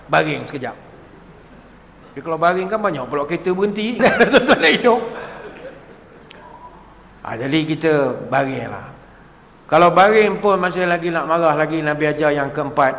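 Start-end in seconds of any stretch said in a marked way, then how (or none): none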